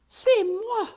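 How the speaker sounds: very high, loud French protest; indignant French. Acted. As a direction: cry it out in wounded French. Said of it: "said Antoinette".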